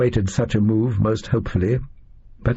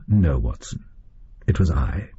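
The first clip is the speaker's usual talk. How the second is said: lower, calm and measured detective; Holmes replying; 5 semitones lower than usual. A narrator's reading. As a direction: drop lower for the composed Holmes. Holmes answers Watson calmly.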